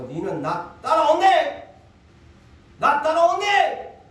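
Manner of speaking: loud, high, slow Gyeongsang command; firm command. Retold or imitated. A pastor voices Jesus firmly ordering a man to follow, loud and slow. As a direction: bark it out as a firm call to follow. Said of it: "주님은 단호했어요".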